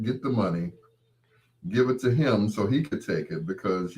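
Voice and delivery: ordinary talk, nothing marked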